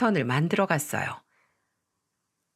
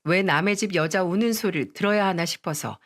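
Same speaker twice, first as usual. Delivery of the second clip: ordinary delivery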